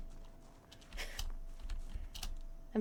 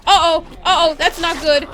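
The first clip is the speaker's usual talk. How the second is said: much louder, high-pitched alarm; panicked; 8 semitones higher, 11 dB louder than usual. Real, not acted. Player sees zombies closing in on her character, panicked. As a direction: blurt it out in rising panic.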